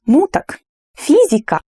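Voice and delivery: obvious, of course. Shown as a demonstration. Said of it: "you strongly agree with that".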